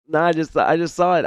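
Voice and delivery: normal talking voice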